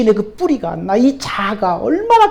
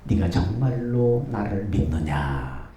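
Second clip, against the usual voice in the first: voice of God; 10 dB quieter, much slower than usual. Acted. God asks again.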